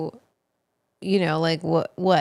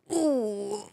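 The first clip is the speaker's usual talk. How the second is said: mock dismayed groan; 5 semitones higher, much slower than usual. Acting out sour-face reaction.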